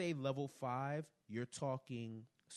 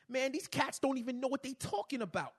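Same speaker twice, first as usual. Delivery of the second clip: put-on classic New York accent; 7 dB louder than usual. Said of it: "That's classic New York".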